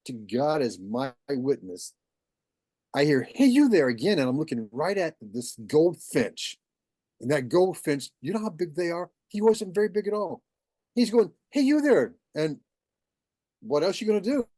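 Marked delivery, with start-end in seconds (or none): 3.2-3.9 high, loud bird call; goldfinch calling. Acted. Hidden goldfinch to man walking around, high loud calling. 11.5-12.2 high, loud bird call; goldfinch calling. Acted. Hidden goldfinch to man walking around, high loud calling.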